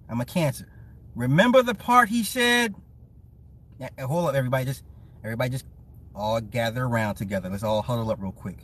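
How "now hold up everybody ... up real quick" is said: low, hushed gather-round; confidential huddle. Pretend. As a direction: draw everyone in as if sharing a secret. Pretends to gather listeners in confidence.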